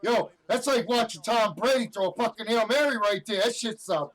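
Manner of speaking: high, loud hyped shouting; stoked sports-fan yell; pumped up. Real, not acted. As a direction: roar it like a game-winning throw. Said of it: "That was me pumped up in a good mood".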